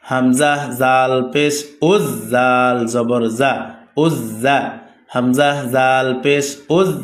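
slow, measured syllable-by-syllable recitation; spelling drill. Shown as a demonstration. Teacher modelling Arabic letter spelling for students, measured drill recitation. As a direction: recite it as a steady drill, sounds drawn out.

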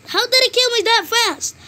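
high outraged disbelief; raging gamer. Real, not acted. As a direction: yelp it in shock at losing. Just got killed.

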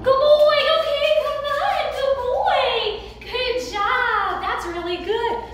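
very high, loud sing-song calling; excited high praise. Real, not acted. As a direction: cheer the dog in with delight.

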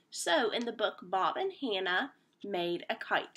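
plain reading voice

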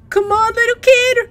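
loud, high, laughing urging; urging excitedly. Real, not acted. Player urging the child upstairs, laughing and loud.

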